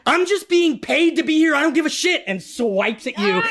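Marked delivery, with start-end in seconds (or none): 0.1-2.2 mercenary NPC voice. Acted. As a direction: toss it off like a hired thug who doesn't care. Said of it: "looks at you and goes".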